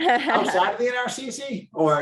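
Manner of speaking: laughing question. Real, not acted.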